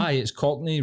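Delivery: ordinary delivery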